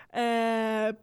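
ordinary talk, nothing marked

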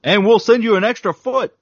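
high, loud infomercial hype; home shopping host. Pretend. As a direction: sell it like a TV pitchman. Parody TV sales pitch.

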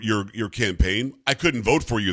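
ordinary talk

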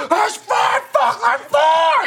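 very high, slow, loud mock rant; imitating a furious friend yelling; having a fit. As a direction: throw a tantrum in a cartoonish shriek. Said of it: "him having a fucking fit".

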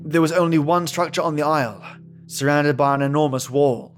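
usual speaking voice

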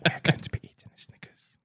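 soft mock-podcast whisper. Announcing a snack to imagined listeners, whispered. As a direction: whisper it softly into the mic.

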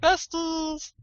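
high squealed exclamation; excited cheer. Real, not acted. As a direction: gush it out, thrilled. Cheering about being best friends.

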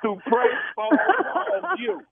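high, sing-song put-on voice; spring the joke in a high put-on tone; prankster reveal voice.

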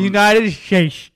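slow "United States" with sh sounds, "Shtates"; slurred "United States" as Trump; imitating Trump's "United States"; Trump saying United States. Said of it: "He said".

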